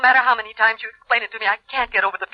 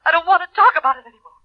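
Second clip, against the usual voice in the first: high, loud outburst cutting him off; upset; 7 semitones higher, 5 dB louder than usual. Acted. Woman refusing to discuss the divorce further, upset. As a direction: break off the talk, pitch leaping up. Ex-wife breaks off painful phone call.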